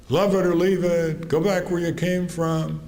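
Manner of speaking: mock taunt from a diner customer; heckling customer. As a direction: throw the taunt back at him. Customers heckling his father.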